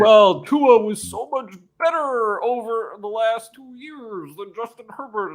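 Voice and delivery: high, drawn-out mocking voice; voice of public opinion. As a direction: start loud and high, stretch it out and fade. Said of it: "my impression of the voice of public opinion".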